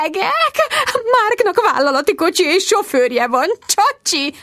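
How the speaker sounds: nagyon magas, affektált felkiáltás; kiálts fel magasan, leereszkedő csodálkozással; csodálkozó nénike. A narrator's reading. Juna felkiáltva kioktatja Bridgetet. (magas hangú, kioktató felkiáltás)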